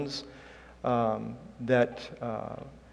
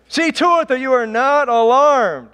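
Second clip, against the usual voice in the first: loud, high-pitched booming emphasis; emphatic shout; 11 semitones higher, 9 dB louder than usual. Shown as a demonstration. Preacher stressing a verse to the congregation, emphatic shout. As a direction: shout it out to drive the statement home. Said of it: "figured out a way to emphasize".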